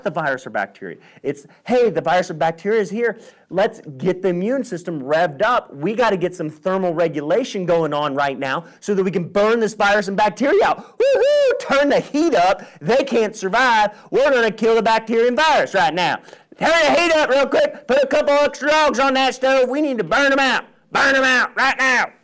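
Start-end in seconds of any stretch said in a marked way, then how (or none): 1.6-11.0 loud, high, excited rally cry to the troops; the body as hyped coach. Pretend. The body rallying its defenses, loud and excited. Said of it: "It's literally in that exact accent". 11.0-11.6 excited whoop. Pretend. Body voice cheering. 11.6-22.1 loud, high, excited rally cry to the troops; the body as hyped coach. Pretend. The body rallying its defenses, loud and excited. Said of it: "It's literally in that exact accent".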